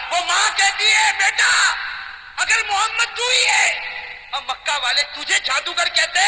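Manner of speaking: roaring preacher at the story's peak; climactic preaching. Acted. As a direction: cry it out to the whole crowd.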